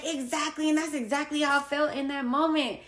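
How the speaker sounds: high-pitched, vindicated agreement; animated "yes, that's it" retelling; emphatic agreement. Retold or imitated. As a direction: jump up in pitch as if finally understood. Retold emphatic agreement with therapist.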